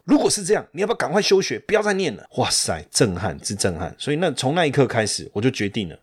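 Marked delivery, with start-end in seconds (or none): 0.0-2.2 higher, bluntly dismissive teacher voice; blunt professor. Retold or imitated. Professor telling the student to quit school, higher and blunt. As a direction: brush the student off as his professor would. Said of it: "他就跟我说".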